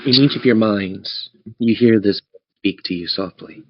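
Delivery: usual speaking voice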